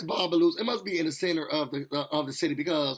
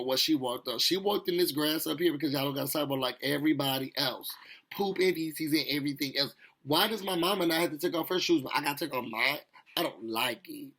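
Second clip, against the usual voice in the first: usual speaking voice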